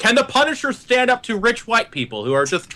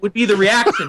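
ordinary talk, nothing marked

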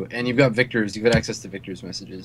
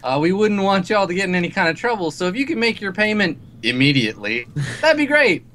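corny cowboy voice; 6 semitones higher than usual. Acted. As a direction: play a hokey cowboy ad voice. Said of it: "this kinda corny sounding, like, cowboy voice comes on".

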